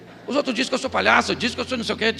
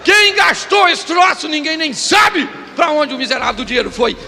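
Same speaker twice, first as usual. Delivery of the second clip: preaching shout; 5 semitones higher, 7 dB louder than usual. Acted. Preacher shouting at the congregation about money. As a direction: hammer it at the congregation.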